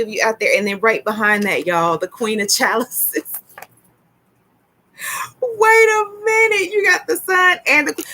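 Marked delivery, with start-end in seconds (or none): 5.4-8.0 thrilled high-voiced double take; astonished. Real, not acted. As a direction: stop everything, pitch way up in amazement. Astonished at the next card drawn.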